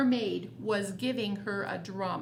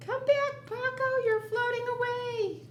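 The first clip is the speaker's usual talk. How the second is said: high, carrying call after her; 12 semitones higher than usual. Acted. Parent calling after the floating Paco, high and carrying. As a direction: call up to a child drifting off.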